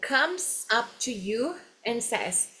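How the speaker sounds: slow, drawn-out teacher's model of an English phrase. Shown as a demonstration. Teacher revisits a phrase seen earlier, slow model. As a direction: spell the phrase out slowly for learners. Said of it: "frase que a gente viu juntos".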